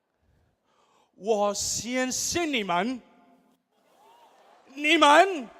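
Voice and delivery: usual speaking voice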